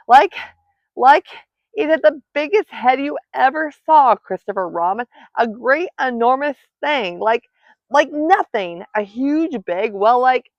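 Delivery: high, loud, halting description; frantic Piglet. A narrator's reading. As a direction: grope for words in a frightened fluster. Breathless Piglet describes the monster.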